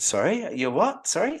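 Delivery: can't believe what you just heard; stunned disbelief. Retold or imitated. Disbelief at the astronaut's claim.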